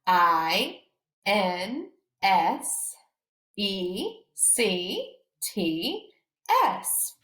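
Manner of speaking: slow spelling with long pauses; each letter called out separately; spelled letter by letter. Shown as a demonstration. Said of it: "I'll spell it one more time".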